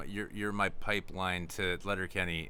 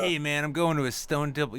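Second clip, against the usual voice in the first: lightly mocked young fan, louder and higher; twentysomething concertgoer; 5 semitones higher, 7 dB louder than usual. Young fan announcing a concert, lightly mocked twentysomething. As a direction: announce it loudly like an excited concertgoer. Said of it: "someone in their twenties".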